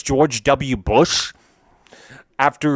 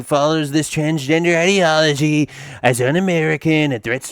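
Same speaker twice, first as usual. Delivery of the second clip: lightly put-on Trump voice, pitched higher; a hint of Trump making a proud announcement; Donald Trump impression; 4 semitones higher than usual. President announcing a new policy, mild Trump impression. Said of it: "my Donald Trump impersonation".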